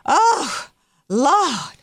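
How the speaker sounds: mock awe of a swooning congregant; mock churchgoer. Mocking a church crowd.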